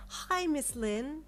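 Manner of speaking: high, slow, sing-song greeting; bubbly night nurse hello. Acted. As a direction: greet the patient brightly. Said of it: "she said in a bubbly tone".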